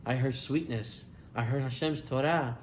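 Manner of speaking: low and soft, sincere; tender recollection. Acted. Boy tells doctors about his father's reading, tender and soft. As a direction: gently explain the sweetness.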